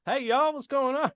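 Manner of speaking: bright high American greeting; loud, high American hello; American accent. Retold or imitated. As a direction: hail a stranger in a big American accent. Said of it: "I'm going like".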